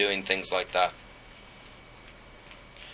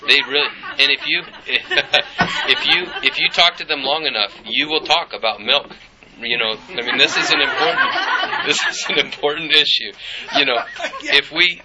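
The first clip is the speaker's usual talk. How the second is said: laughing; 4 semitones higher than usual. Real, not acted. Chuckling about raw-milk Christians.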